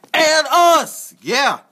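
high, loud battle cry; shouting. Acted. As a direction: yell it like joining a brawl. Toys join the fight, calling out.

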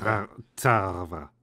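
"terve" as a drawn-out "taava", hesitant; wrong R. Retold or imitated. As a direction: try "terve" but lose it into "tava". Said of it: "No, no R-ing".

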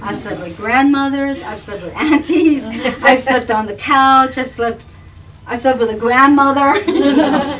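loud, high, laughing boast; laughing joke. Real, not acted. Joking to the hosts about sleeping over, laughing loudly. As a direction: play the double meaning for laughs.

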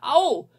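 sharp, high, loud yelp; yelp of pain. Acted. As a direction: cry out from burnt fingers. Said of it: "schreit sie dabei".

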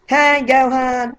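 high-pitched, slow cartoon voice; high anime character voice, drawn out; Japanese voice-actor impression. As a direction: do the squeaky Japanese dub voice. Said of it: "I'm gonna do the Japanese voice".

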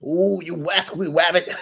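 put-on cartoon hunter with a chuckle; Elmer Fudd. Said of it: "Elmer Fudd".

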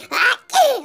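high, sharp sneeze. Acted. Doraemon sneezing again and again. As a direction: let another sneeze explode. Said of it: "Kok jadi bersin-bersin gini?".

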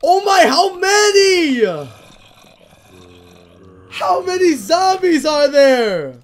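slow, loud, whiny cry; despairing wail. Real, not acted. Gamer facing an endless zombie horde, despairing wail. Overwhelmed, crying shout.

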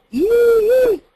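very high loud yee above the pencil. Shown as a demonstration. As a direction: fire a high yee forward. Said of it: "I demonstrate that".

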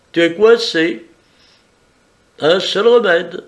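smug and in control, lifted voice. Acted.

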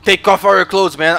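mock cop yelling at a suspect to strip; loud cop order. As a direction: bark it like an impatient cop. Plays cop ordering a search.

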